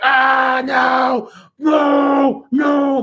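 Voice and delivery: half-joking scream of no; mock horrified yell. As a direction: recoil and shout in horror. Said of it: "I'm like".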